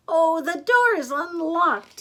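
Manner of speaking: high, loud, slow granny; Granny's voice. Acted. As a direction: call out to the visitor as Granny. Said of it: "said Granny".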